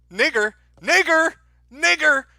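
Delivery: high, slow, shouted out in a put-on voice; mock shouted slur. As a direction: bellow it like a ranting caricature. Trump voiced in a mock transcript.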